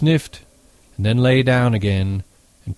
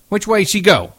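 high, raised, impatient question; sharp demand; 10 semitones higher, 4 dB louder than usual. A narrator's reading. Curly pressing George about the girl, demanding. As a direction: demand an answer with voice pitched up. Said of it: "he demanded at last".

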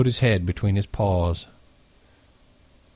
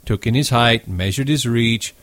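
plain reading voice

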